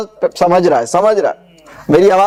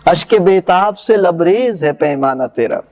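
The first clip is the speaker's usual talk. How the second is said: measured, lower verse recital; poem recitation; 4 semitones lower than usual. Acted. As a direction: recite the couplets with gravity. (شاعرانہ انداز میں پڑھت)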